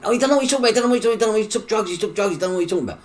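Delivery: high, loud, racing mock panic; frantic excuse. Brother hurriedly covering for the man in bed, frantic and loud. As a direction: gabble an excuse over and over.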